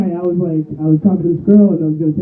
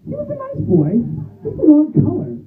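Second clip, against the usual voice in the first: put-on high old lady voice; a hint of a sweet granny; imitating grandma; 10 semitones higher than usual. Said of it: "my grandma says".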